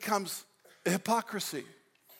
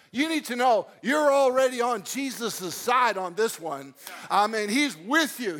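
ordinary talk

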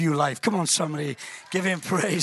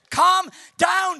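mock calming shout; 10 semitones higher, 6 dB louder than usual. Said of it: "And Jesus is".

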